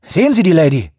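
high, loud, anxious question; breathless urgent shout. A narrator's reading. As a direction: call out desperate for news. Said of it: "rief Shoemaker atemlos".

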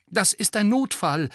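short, firm answer; curt reply. A narrator's reading. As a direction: cut the gargoyle off briskly. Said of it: "erwiderte Harry knapp". (knapp und bestimmt)

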